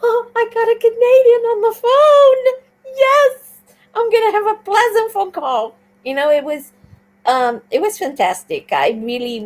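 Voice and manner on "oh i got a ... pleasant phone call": delighted. Retold or imitated. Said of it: "I was like".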